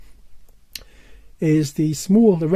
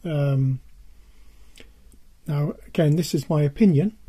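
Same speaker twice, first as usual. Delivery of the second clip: ordinary delivery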